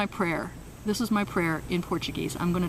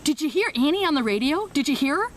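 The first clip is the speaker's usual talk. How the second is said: higher gushing tease to the women; teasing brag; 7 semitones higher than usual. Retold or imitated. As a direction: show her off with a tease. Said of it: "she starts telling all the women".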